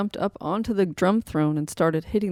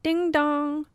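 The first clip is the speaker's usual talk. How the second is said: doorbell sound; 9 semitones higher than usual. Acted. Doorbell pressed.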